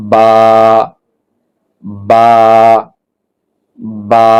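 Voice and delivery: "বা" from both lips, closed then opened naturally; Arabic letter demonstration. Shown as a demonstration. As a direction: say each "বা" as a held, separate syllable. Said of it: "দুই ঠোঁট বন্ধ করেন স্বাভাবিকভাবে".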